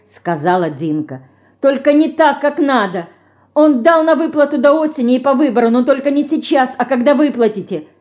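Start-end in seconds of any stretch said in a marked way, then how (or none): 1.6-7.9 fast, raised, rattling it off; in one breath. Acted. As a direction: blurt it all out at once. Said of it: "залпом сообщила она". (залпом, быстро и высоко)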